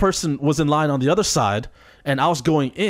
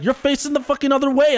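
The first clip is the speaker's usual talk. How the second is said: high, exasperated protest; mock exasperated retort; 9 semitones higher than usual. Retelling the gas-station standoff to a friend, high and exasperated. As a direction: throw it back at him, pitched up in disbelief. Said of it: "And I was like".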